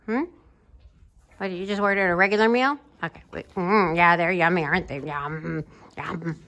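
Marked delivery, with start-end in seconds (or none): 3.5-6.4 low "mm, yum" savoring; low, play-acted yummy murmur; pretend savoring. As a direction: drop the voice low as if relishing food. Pretending the meal is tasty.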